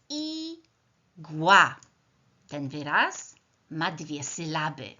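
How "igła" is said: "Igła" as two beats, i - gła, with a gap; split into syllables. Shown as a demonstration. Teacher clapping words into syllables with pupils, "Igła" syllable by syllable. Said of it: "Podzielmy teraz każdy z tych wyrazów na sylaby".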